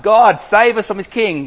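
high, loud plea to God; desperate cry. Acted. The people begging God to save them from their king, desperate. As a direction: cry out for rescue.

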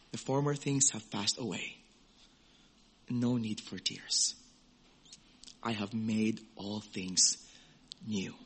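hushed gentle voice of God comforting. Acted. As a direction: soothe a crying child. God's comforting words voiced.

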